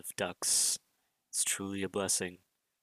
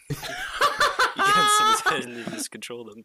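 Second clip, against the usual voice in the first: high, loud words through laughter; laughing; 10 dB louder than usual. Real, not acted. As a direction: say it while cracking up. Laughs while speaking.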